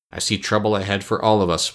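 plain reading voice

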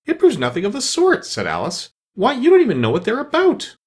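put-on high, bright young girl protesting; indignant Alice. Alice objecting to the queen, indignant. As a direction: push back at the queen.

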